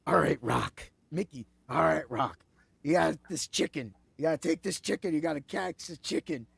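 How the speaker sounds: low-pitched old-movie trainer impression; imitating a film trainer, pitched well down; Mickey. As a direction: coach the boxer in a low character voice. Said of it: "he's like".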